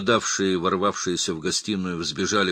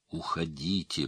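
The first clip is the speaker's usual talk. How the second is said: softer, strained narrated plea; hoarse pleading; 2 semitones lower, 7 dB quieter than usual. A narrator's reading. Wounded father begs the others to leave, softly hoarse plea. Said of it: "прохрипел он умоляюще".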